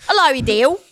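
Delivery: hammed-up Cockney hello, lifting at the end; mock Cockney accent. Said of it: "Like, in your Cockney accent".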